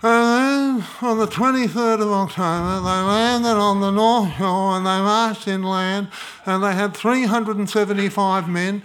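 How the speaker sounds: loud, plodding list of dry facts; dull history recital. Pretend. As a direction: recite it like a boring textbook. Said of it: "the image of non-fiction is".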